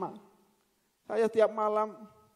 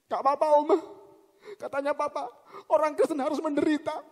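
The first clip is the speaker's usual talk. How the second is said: imitating a child crying while eating; high, hammed-up sobbing voice; tearful mock whimper; 6 semitones higher, 3 dB louder than usual. Grandchild answering Oma while crying over food, mock sobbing. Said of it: "Sambil nangis makan".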